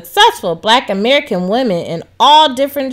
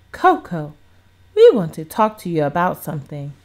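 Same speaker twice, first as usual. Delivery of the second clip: lower, serious and gentle; somber tone; 6 semitones lower than usual. A narrator's reading. Mother starting a serious talk with daughter, low and somber. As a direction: ease into hard news with a low somber voice. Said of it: "in a somber tone".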